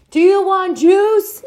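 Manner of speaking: high, slow screamed question; screaming. A narrator's reading. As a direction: scream over the blaring TV. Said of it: "Mom screamed".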